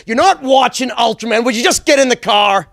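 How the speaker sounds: put-on loud, high angry shout at the kid; father screaming. Father yelling at his son to get in the car, loud angry shout. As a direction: bark it like a furious dad. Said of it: "my father would always start screaming".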